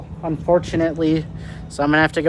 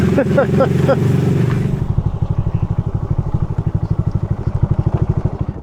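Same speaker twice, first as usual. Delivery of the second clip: breathy laugh; 7 semitones higher than usual. Real, not acted. After lost on narrow path.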